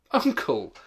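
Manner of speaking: loud pleading call. Acted. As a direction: plead with him loudly. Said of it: "pleaded the nephew".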